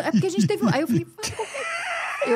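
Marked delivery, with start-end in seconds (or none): 1.1-2.3 much higher, slow tearful wail; half-mocking retold sobbing plea; crying plea. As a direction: beg through tears, drawn out and high. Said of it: "Eu chorava assim".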